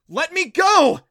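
high, full-force bellow. A narrator's reading. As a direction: roar it out, high and very loud. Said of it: "he bellowed".

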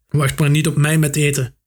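ordinary delivery

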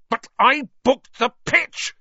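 high, loud furious protest; enraged, strained shout; spitting with rage. A narrator's reading. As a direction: spit each word out in rage. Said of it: "positively spitting with rage".

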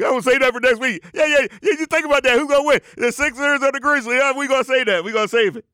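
high, hyped preacher's rush; excited. Acted. The Rev saves his final pick for next week, high excitement. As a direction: get carried away, high and excited. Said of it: "The Rev excited".

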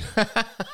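high, harsh mocking cackle; harsh laugh. A narrator's reading. Jada laughing at her cousin's comeback, harsh high laugh. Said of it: "She laughed harshly".

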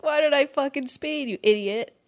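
high mock self-scolding; high, mock-frustrated self-berating. Scolding herself when pulled over.

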